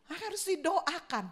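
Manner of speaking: usual speaking voice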